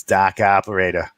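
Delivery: put-on dropped R, South Boston accent. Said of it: "sounding like South Boston".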